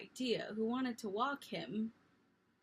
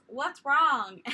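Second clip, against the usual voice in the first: loud call from far off; yelled; 4 semitones higher, 11 dB louder than usual. Retold or imitated. Mom yelling from bed to her scared child. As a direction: yell it down the hall. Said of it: "she yells".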